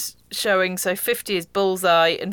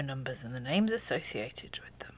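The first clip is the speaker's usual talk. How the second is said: hushed whisper; breathy aside under the voice; 7 semitones lower, 12 dB quieter than usual. Real, not acted. A sudden aside.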